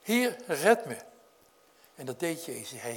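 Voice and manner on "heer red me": louder, higher desperate cry; cry for help. Acted. Peter crying out to Jesus while sinking, desperate. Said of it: "hij schreeuwt het uit".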